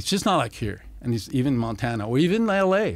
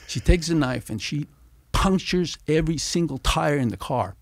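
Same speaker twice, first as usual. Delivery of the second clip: ordinary talk, nothing marked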